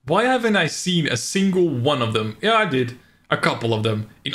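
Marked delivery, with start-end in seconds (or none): 0.0-2.0 incredulous. Real, not acted. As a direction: repeat it in disbelief.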